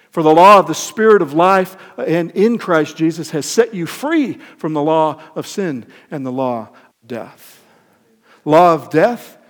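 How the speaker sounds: loud, ringing sermon cadence; preaching at full force. Acted. Preacher rousing the church, loud and ringing. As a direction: preach it out to the congregation.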